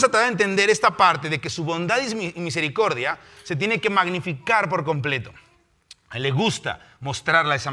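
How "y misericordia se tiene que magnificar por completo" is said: usual speaking voice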